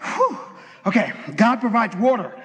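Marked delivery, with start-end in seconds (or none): 0.0-0.4 high, puffed-out exhale; winded whew. Real, not acted. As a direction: blow out a breath after rushing. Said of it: "I'm gonna need my asthma pump".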